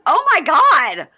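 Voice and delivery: very loud, high, drawn-out shriek; imitated yelling. Cast members watching him flirt, imitated appalled yelling. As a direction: shriek it slowly like an appalled crowd. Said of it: "All the cast members are yelling".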